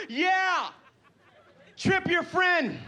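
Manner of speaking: high-pitched yelled-out heckle; imitated yell. Heckler calls out to the comic, yelling. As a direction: call it out high, drawing out the last words. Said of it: "This guy yelled out".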